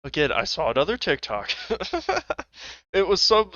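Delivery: ordinary delivery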